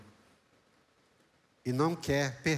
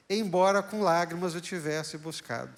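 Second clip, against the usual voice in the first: preached scripture reading; 5 semitones higher, 4 dB louder than usual. Acted. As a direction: read the verse like a pulpit proclamation. (leitura bíblica pregada, lenta e forte)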